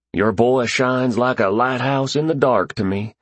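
high, lilting Southern drawl. A narrator's reading. Pup tells Vivian about her boy, Southern drawl. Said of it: "the man said in a strong southern drawl".

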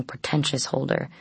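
ordinary delivery

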